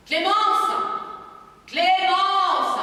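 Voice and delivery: an angry father calling his wife; calling out angrily. Acted. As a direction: bellow the name.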